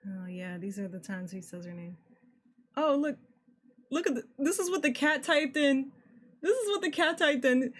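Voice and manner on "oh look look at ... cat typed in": raised pitch, excited pointing-out; excited discovery. Real, not acted. Player spotting what the cat typed, excited and high. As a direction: show it off eagerly, voice lifted.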